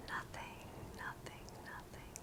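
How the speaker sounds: barely audible whispered echo; fading whisper. Acted. Meditation guide echoing the key word to listeners, whisper fading away. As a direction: let each repetition fade toward silence.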